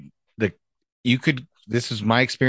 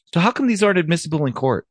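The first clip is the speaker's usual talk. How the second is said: innocent curiosity; 8 semitones higher, faster than usual. Retold or imitated. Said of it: "I was being honest. I was just being honest and curious".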